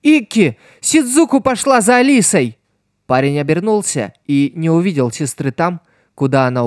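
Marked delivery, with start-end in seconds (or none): 0.0-2.6 high, loud call across a fight; shouting. A narrator's reading. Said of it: "принцесса прокричала в ответ".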